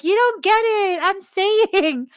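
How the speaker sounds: high, loud, emphatic retold plea; exasperated insistence. Friend insisting she is not understood, exasperated.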